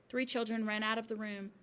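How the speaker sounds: ordinary delivery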